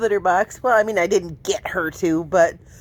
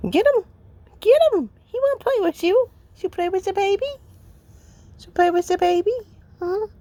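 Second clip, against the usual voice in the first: high pet talk; 9 semitones higher than usual. Real, not acted. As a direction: urge the cat to play. Urging cat to play.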